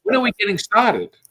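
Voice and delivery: high, loud, eager impatience; impatient. Retold or imitated. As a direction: press for the show to start. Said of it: "They're like".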